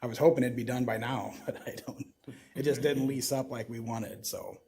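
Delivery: ordinary delivery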